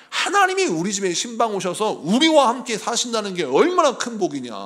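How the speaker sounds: usual speaking voice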